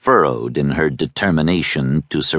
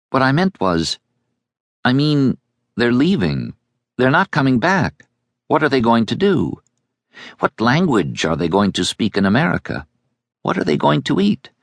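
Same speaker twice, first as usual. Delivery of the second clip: lightly voiced girl, higher, with questioning rises; a worried girl asking question after question; young girl, anxious; 7 semitones higher than usual. A narrator's reading. Girl worrying to the boy about the emigrants, lightly voiced and anxious. Girl character presses her point.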